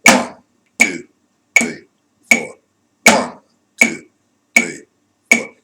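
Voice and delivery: loud, even count on the beat; counting quarter notes. Shown as a demonstration. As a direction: count out a steady tempo, one word per beat. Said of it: "played quarter notes".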